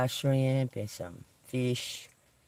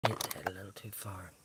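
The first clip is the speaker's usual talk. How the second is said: whispering; 6 semitones lower, 17 dB quieter than usual. Real, not acted. Muttering after a mishap.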